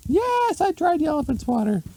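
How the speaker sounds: very high squeaky voice; exasperated lady. A narrator's reading. Wife answering husband about the swatter, high exasperated squeak. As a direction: snap back in a tiny high voice.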